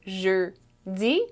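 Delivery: "jeudi" broken apart with a gap after "jeu"; syllable breakdown. Shown as a demonstration. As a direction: separate "jeu" and "di", rising on "di". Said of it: "Jeudi has two syllables".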